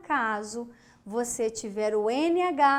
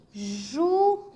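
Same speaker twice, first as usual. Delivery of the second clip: "Juízes" higher, in separate pieces with pauses; syllable by syllable; 4 semitones higher than usual. Shown as a demonstration. Teacher gives an example, "Juízes" split by syllable.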